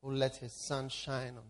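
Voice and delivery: soft, hushed prayer over music; soft worship leading. Acted. Pastor leading the congregation in quiet worship, hushed and prayerful.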